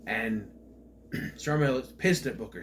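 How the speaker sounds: ordinary delivery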